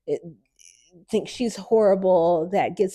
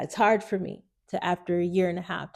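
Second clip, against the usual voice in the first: ordinary delivery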